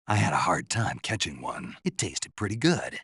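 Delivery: ordinary talk, nothing marked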